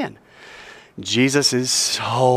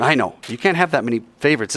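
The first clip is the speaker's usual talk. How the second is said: ordinary delivery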